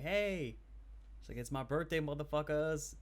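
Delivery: imitating a broken Japanese accent; a light Japanese accent. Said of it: "with a very broken Japanese accent".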